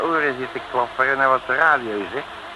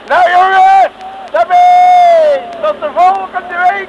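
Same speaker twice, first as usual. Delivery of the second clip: very high, loud farewell cry; captain calls goodbye over the waves, full shout; 9 dB louder, slower than usual. Acted. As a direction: yell it above the roaring sea. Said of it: "roept boven de woelige baren uit".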